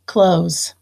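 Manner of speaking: "Close" said slowly with a clear buzzing Z; model word close. Shown as a demonstration. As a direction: model "Close" so the final S sounds like Z. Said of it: "the first word I want to build is close".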